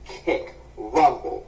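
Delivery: usual speaking voice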